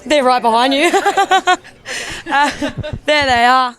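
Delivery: real laugh at the police nearby. Real, not acted.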